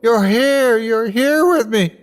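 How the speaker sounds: high, loud joyful crying out; overjoyed tearful shout. A narrator's reading. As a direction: cry it out with joy. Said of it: "I cried".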